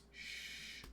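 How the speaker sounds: whispered shush. Acted. Shushes after Hush flavor text.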